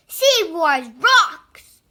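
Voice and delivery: loud, gleeful cheer; excited cry. A narrator's reading. Clark cheering while playing with the toy, excited. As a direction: shout it out with delight. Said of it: "cried Clark".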